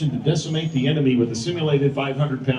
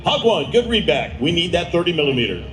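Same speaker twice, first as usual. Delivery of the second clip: urgent, higher radio calls; military radio controller; 3 semitones higher than usual. Acted. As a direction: bark out the grid like a JTAC. Announcer voices ground controller.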